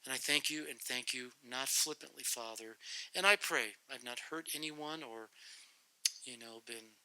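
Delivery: soft earnest prayer voice. Acted. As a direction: pray humbly to close. Closing prayer.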